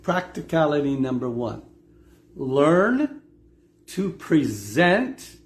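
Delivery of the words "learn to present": "present" drawn out with a rising end; slow, emphatic "present", pitched upward at the end; stress on PRESENT. Shown as a demonstration. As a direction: lean slowly on the word "present". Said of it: "emphasis on the word present".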